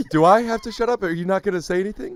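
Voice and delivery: high-pitched, put-out questioning; mock indignation. Host acts the parent without the final say, mock indignation. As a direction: protest in a high, mock-offended voice.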